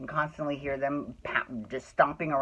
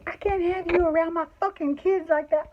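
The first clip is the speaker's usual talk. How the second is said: imitating the screaming neighbor, higher; a hint of a shrill mom scolding; mock neighbor yelling; 13 semitones higher than usual. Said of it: "She's screaming at him".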